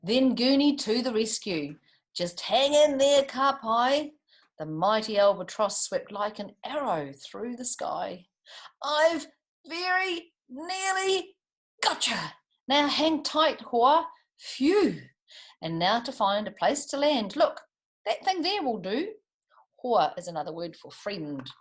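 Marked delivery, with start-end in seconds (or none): 2.1-4.1 louder, higher encouraging call. A narrator's reading. Albatross calls to the falling kiwi. 8.8-19.1 higher, lively rescuer voice; a touch of heroic urgency; rescuing albatross. A narrator's reading. Albatross catches kiwi mid-air, lightly voiced hero.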